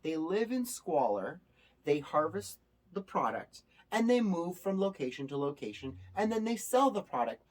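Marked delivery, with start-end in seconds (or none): none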